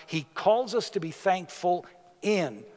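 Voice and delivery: ordinary delivery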